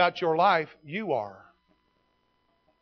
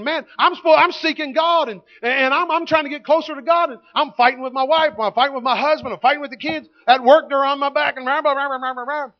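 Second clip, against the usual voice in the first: frantic complaint; 6 semitones higher, 6 dB louder than usual. Retold or imitated. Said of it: "And you're thinking".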